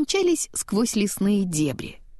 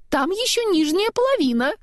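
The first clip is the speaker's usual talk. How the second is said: warning; 11 semitones higher, 3 dB louder than usual. A narrator's reading. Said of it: "предупредила голова".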